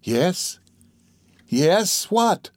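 rising, urgent prompting; eager cry. Acted. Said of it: "cried the other".

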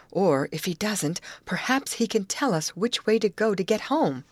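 usual speaking voice

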